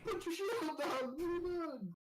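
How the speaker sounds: hammed-up frantic yell, high and strained; retold scream. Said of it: "he literally screamed out".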